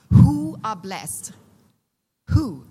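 normal talking voice

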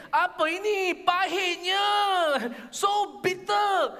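high, loud, drawn-out outrage; angry complaining. Acted. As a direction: wail in hammed-up disgust at the taste. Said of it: "that's why they got angry".

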